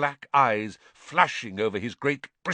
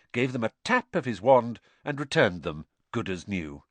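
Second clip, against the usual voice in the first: usual speaking voice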